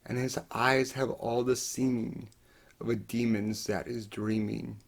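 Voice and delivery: very soft, near-breathless closing; hushed. Acted. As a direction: let it sink almost to nothing.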